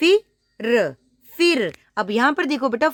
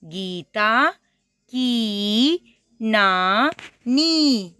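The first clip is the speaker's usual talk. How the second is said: नानी stretched very slowly, each syllable long; slow drawn-out reading; much slower than usual. Shown as a demonstration. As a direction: spell it out slowly for a child. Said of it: "तो आपने वैसी ही आवाज़ निकालनी है इसकी".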